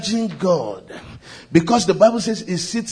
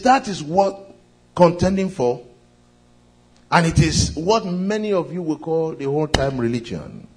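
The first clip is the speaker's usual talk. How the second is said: normal talking voice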